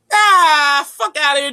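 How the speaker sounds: high, loud scoff; mock dismissive shout. Beings brushing off the speaker, high and loud scoff. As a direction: laugh him off loudly.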